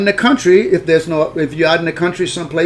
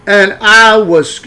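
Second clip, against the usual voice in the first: ordinary delivery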